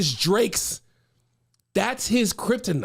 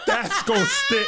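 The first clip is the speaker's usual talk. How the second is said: much higher and laughing; delighted; 12 semitones higher than usual. Real, not acted. Host repeats the verdict, laughing and delighted. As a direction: squeal it out in delight.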